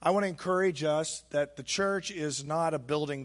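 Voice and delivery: ordinary talk, nothing marked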